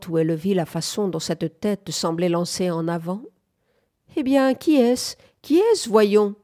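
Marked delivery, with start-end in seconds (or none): 4.2-6.3 lightly voiced caustic old man, pitched up; an old man's insistent, louder questioning; mumbling old man. A narrator's reading. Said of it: "de sa voix marmotteuse".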